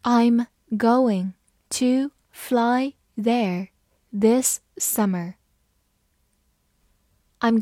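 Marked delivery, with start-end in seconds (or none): 0.0-5.3 very slow, word-by-word model sentence; slow reading. Shown as a demonstration. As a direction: read it slowly, each word apart. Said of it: "好，慢慢来读". (慢慢地一词一词读)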